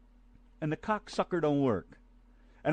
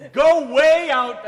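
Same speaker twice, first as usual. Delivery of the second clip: mock order; 7 semitones higher, 11 dB louder, much slower than usual. Bossing co-host away.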